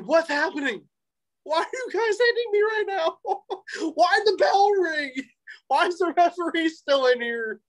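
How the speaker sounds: very high, half-crying confusion; mock bewildered panic. Wrestler baffled in the ring, mock panic very high. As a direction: whine it like you're about to cry. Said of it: "I, I, like".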